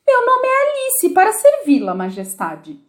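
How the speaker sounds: put-on high, polite little girl's answer; very politely. A narrator's reading. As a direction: answer the queen sweetly and politely. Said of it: "respondeu Alice muito educadamente". (resposta muito educada, voz aguda de menina)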